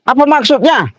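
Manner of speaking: high-pitched indignant demand; angry challenge. Real, not acted. Driver demanding the blocker's intent, high angry challenge. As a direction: demand an answer, voice right up high.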